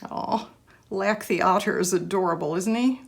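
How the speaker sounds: normal talking voice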